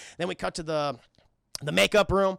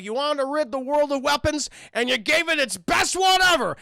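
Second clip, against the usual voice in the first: rising into a higher, louder shout; villain taunt; 6 semitones higher, 4 dB louder than usual. Retold or imitated. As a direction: taunt him, building to a shout.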